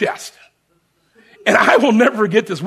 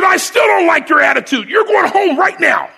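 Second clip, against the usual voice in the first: higher, louder shouted verdict; retold drill sergeant yell; 8 semitones higher, 4 dB louder than usual. Sergeant sending recruit home, imitated shout. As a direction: throw him out with a yell. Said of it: "He said".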